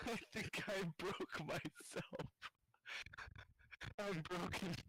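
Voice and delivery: high, breathless giggling fit through every word; cracking up, barely able to get words out, tears streaming; helpless laughter. Real, not acted. Alone in his bedroom at night, laughing uncontrollably at his own joke. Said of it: "I'm fucking in tears".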